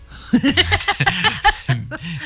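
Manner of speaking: high laugh. Real, not acted. Teacher amused by cat joke.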